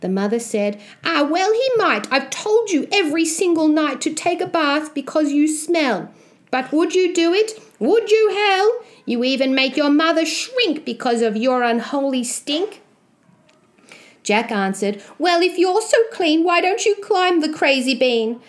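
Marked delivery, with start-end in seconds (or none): 1.0-12.8 high-pitched exasperated scolding; nagging mother. Acted. Mother scolding her son to bathe, high exasperated nagging. 15.2-18.4 put-on high-pitched challenging boy; cheeky retort. Jack daring his mother to climb, high cheeky retort.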